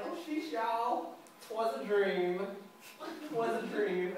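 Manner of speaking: high drawn-out catchphrase; imitating an absurd comic character; Amir. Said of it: "key phrases that don't mean anything".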